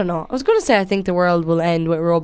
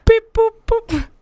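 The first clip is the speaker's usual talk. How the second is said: robot voice; 14 semitones higher than usual. Pretend. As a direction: chirp the beeps like a robot. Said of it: "We're robots".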